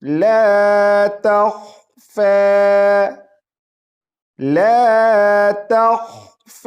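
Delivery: لا تخفى chanted very slowly, لا held long; tajweed recitation. Shown as a demonstration. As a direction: draw out لا تخفى melodically. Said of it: "آگے دیکھیں،".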